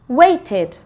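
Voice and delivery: WAITED in two syllables, ending in ID; teacher modelling the ID at the end of waited. Shown as a demonstration. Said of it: "id at the end".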